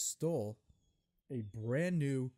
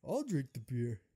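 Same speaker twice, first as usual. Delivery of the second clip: loud put-on bravado; mock tough guy; 5 semitones higher, 4 dB louder than usual. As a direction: play a guy trying to act tough. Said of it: "tries to act tough".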